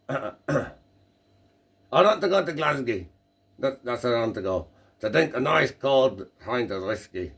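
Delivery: hammed-up Scottish accent, pitched high; second, bigger stab at a Scottish voice; attempted Scottish accent. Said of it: "Is that meant to be a Scottish accent".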